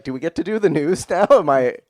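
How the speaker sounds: usual speaking voice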